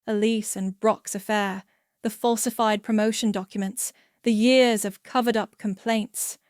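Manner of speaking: ordinary delivery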